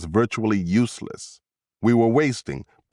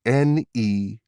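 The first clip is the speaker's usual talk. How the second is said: "N E one" as separate letters with pauses; spelled out slowly; 2 semitones higher, much slower than usual. A narrator's reading. Investigator working out the keyboard clue, "N E one" spelled slowly. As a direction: say "N", "E", "one" deliberately, one by one.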